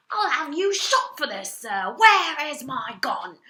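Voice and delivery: high, loud, outraged shouting; furious shouting duke. A narrator's reading. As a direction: bellow at the thieving bird, full fury. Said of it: "the Duke was shouting".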